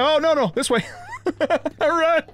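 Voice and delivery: panicked high yelling; frantic shouts while fleeing; being chased. Real, not acted. Cop aggroed, fleeing in game.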